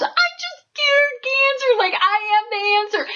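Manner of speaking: hammed-up triumphant shout; mock mountaintop shout. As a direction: belt it out like shouting from a peak. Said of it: "you're screaming from the mountaintops".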